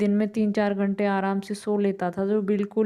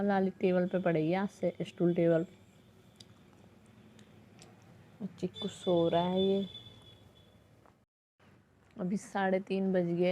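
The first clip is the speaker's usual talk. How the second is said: hushed voice; 7 dB quieter than usual. Real, not acted. Chiku asleep, speaking low.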